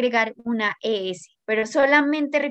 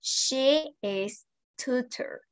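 drawn-out, very slow "She is tutor"; slow wrong version; 4 dB quieter, much slower than usual. Shown as a demonstration. Teacher to students, a slow drawn-out version of the phrase. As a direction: spell out "She is tutor" slowly, like a teacher testing the class.

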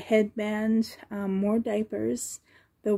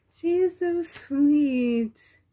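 slow cooing; 5 semitones higher, 5 dB louder, much slower than usual. Real, not acted. Gushing over the doll.

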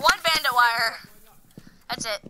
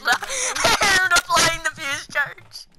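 helpless laughter stretching out the sentence; can barely get it out for laughing; laughing while talking; 4 semitones higher, slower than usual. Real, not acted. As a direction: crack up through the words. Cracks up over what was heard.

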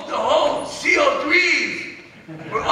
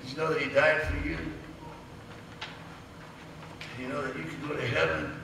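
soft; 9 semitones lower, 12 dB quieter, faster than usual. Retold or imitated. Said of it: "He's like".

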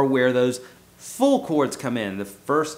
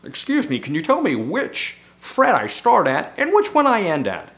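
high, prim and overly polite doctor; 7 semitones higher, 5 dB louder than usual. Retold or imitated. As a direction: put on a proper, nasal-free polite doctor act, pitched up.

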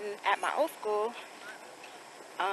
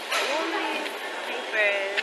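slow exasperated drawl; much slower than usual. Real, not acted. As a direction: stretch all of these papers out slowly, weary. Repeats phrase drawn out.

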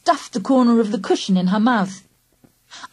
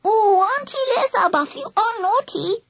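put-on high lisping little-girl scolding; chiding toddler; 13 semitones higher than usual. A narrator's reading. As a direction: chide playfully like a three-year-old. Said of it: "she chided".

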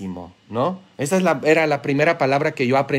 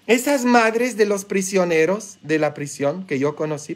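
ordinary delivery

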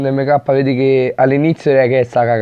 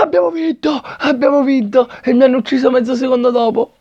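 high excited victory shout; excited cheer; 10 semitones higher than usual. Real, not acted. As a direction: celebrate the win. Winning the match, cheering.

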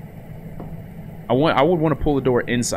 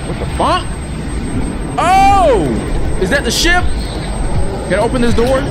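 startled scream; 11 semitones higher, slower than usual. Real, not acted. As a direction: jump out of your skin. Jump scare in horror game.

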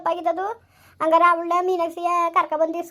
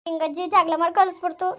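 normal talking voice

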